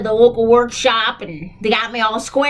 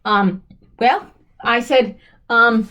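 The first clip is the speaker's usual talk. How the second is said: normal talking voice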